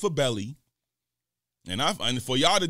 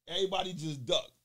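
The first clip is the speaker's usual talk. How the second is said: ordinary talk, nothing marked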